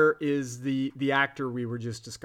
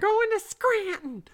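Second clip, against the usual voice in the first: Edith Bunker yell. Retold or imitated. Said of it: "yelling Scranton".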